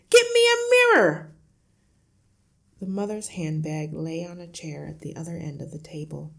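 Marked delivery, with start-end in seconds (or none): 0.1-1.3 very high, full-force scream; piercing, desperate demand at top volume; frantic yell. A narrator's reading. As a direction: scream the order in a panic. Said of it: "the father yelled".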